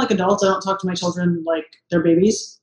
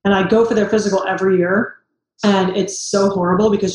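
usual speaking voice

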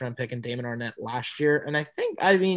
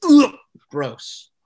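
disgusted groan at the thought; loud, grossed-out ugh; annoyed ugh; 10 dB louder than usual. Real, not acted. Disgust at rival team pick.

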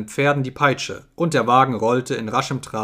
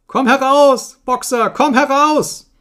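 very high, loud, drawn-out screams; crowd's cry; 13 semitones higher, 9 dB louder, slower than usual. A narrator's reading. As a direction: scream desperately after the departing friend. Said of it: "nahmen Clovers Schrei auf".

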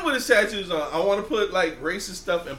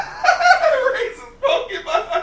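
laughing shout; 6 dB louder, much slower than usual. Real, not acted. Cracking up at the joke.

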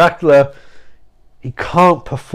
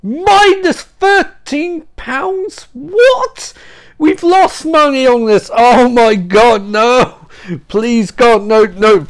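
high, drawn-out disbelief; hammed-up shock; mock horror; 5 semitones higher, much slower than usual. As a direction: act appalled, starting high and stretched out, easing into pleading. Mock horror at donation total.

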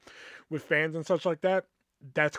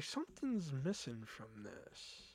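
soft musing; 14 dB quieter, slower than usual. Retold or imitated. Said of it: "I'm like".

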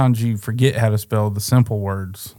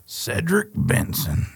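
Cedric Benson stretched out slowly; slow, savoured name; 4 semitones higher, 4 dB quieter, slower than usual. Shown as a demonstration. Fan dwells on a name he admires, savouring it slowly. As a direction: linger over the name.

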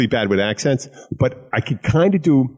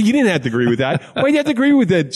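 mock indignant; 9 semitones higher, faster than usual. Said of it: "I said".

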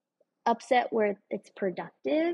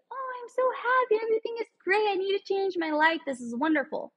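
high, sing-song fake happiness; sarcastically chirpy, pitched way up; lightly mocking a blissfully content person; mock cheerful; 9 semitones higher than usual. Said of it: "you're like".

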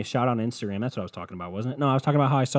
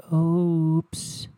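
drawn-out teasing oops; mock oops; 4 semitones higher, 3 dB louder, much slower than usual. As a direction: sing it as a sarcastic slip. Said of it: "mocking pay more interest".

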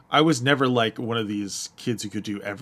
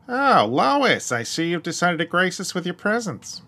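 high cartoon husband impression; Peter; 8 semitones higher, 5 dB louder than usual. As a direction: greet the wife in a raised cartoon voice. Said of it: "I've heard you do Peter".

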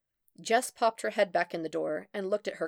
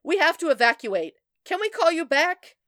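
high, loud, urgent shout; panicked shouted phone call; shouting into receiver; 10 semitones higher, 10 dB louder than usual. A narrator's reading. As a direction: yell over the alarm. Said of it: "shouted into the receiver".

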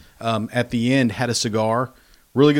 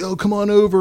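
calling over; 9 semitones higher, 4 dB louder than usual. Retold or imitated. Friends texting invitation, acted out.

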